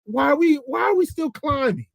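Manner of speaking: very high-pitched worried questioning; mock alarm. Man afraid of heights as car keeps climbing, mock alarm. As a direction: act out rising dismay, pitch climbing to the last question. Acting scared rider as climb continues.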